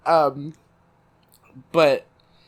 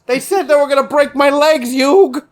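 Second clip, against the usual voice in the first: high, loud pleading New Yorker; desperate New York tough-luck plea; New York accent; 9 semitones higher, 6 dB louder than usual. Acted. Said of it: "what's a New York food".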